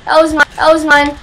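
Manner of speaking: excited claim. Real, not acted. Excited claim after a kill.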